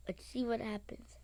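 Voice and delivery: hushed whisper before the start. Real, not acted. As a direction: breathe it under your breath. Nervous waiting for the game.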